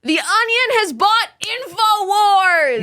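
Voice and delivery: high, ringing headline reveal; grand announcement. Acted. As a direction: proclaim the big story up high. Host announces the big story.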